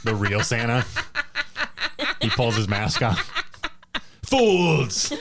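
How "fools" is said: a hint of a gloating villain; lightly voiced unmasked villain; villain reveal. Judge unmasks as Santa.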